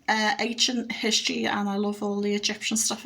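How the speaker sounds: normal talking voice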